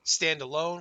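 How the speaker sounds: ordinary talk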